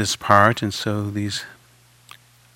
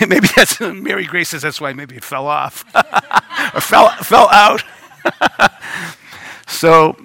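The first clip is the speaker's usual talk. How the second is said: higher pitch, breaking up with laughter; laughing; 8 semitones higher, 5 dB louder than usual. Real, not acted. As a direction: chuckle through your own joke. Joking about hair, laughs while speaking.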